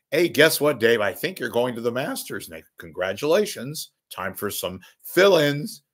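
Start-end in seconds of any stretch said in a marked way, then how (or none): none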